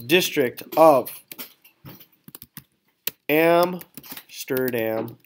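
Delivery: slow, measured dictation of a model text; slow dictation while typing. Shown as a demonstration. Teacher typing a sample monologue for the class, slow dictation. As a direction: read out slowly, word by word, as you type.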